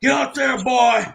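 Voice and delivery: hammed-up Southern taunt, boy on repeat; mock shout. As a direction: yell it like a heel taunting in the ring. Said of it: "Saban, like".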